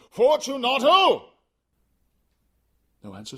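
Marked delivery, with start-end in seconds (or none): 0.1-1.3 higher, louder, slower call; calling again, louder. Acted. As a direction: shout the name into the dark. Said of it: "I called again".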